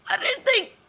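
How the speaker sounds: very high, loud choked plea; choking. Acted. Strangled director gasps at Jake, very high choked. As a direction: choke the words out. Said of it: "the man chokes out".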